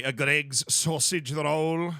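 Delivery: slow, exaggerated British accent; drawn-out British "sausage roll". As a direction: put on a British accent, stretching the words. Redoes phrase in British accent.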